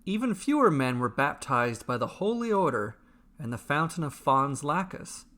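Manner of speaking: ordinary delivery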